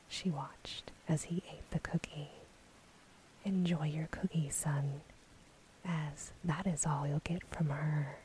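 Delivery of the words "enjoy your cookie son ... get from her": ordinary delivery, nothing marked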